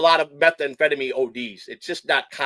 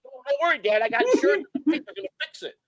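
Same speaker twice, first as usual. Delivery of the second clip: much higher mock child reassuring his dad; mock kid voice; 10 semitones higher, 3 dB quieter than usual. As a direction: squeak it out like a cocky little kid. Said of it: "repeating the kid's line".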